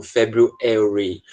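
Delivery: slow American February, stress on the "e" of February; February in an American accent, stress on "Feb". Shown as a demonstration. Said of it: "ele coloca ênfase, geralmente, no E".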